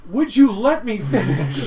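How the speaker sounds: high, loud laughing plea; laughing GM begging players to let him finish; laughing protest. Real, not acted. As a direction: beg to finish through laughter. GM cutting off players.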